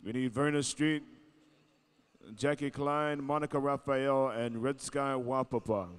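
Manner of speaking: arena announcer. Acted. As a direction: summon the Golden Age dancers to the floor. MC calling judges.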